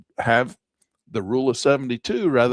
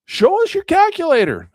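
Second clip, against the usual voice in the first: mock viewer request; 10 semitones higher, 8 dB louder than usual. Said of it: "You're like".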